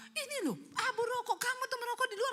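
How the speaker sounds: nagging wife. Acted. Istri mengomeli suami soal abu rokok. (omelan istri bernada tinggi)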